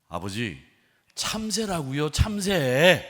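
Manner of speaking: irritated, building to a loud high shout; annoyed. Acted. Son fed up with father's question, annoyed shout. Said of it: "아들이 이제는 짜증난 목소리로".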